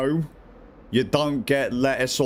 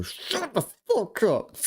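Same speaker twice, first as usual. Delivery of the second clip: higher, slow drawn-out put-down; mock snap; 8 semitones higher, slower than usual. Player shutting down a bully, slow high mock snap. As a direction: drag out the put-down. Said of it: "And I go".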